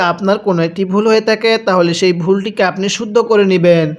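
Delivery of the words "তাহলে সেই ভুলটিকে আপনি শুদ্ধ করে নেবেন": ordinary delivery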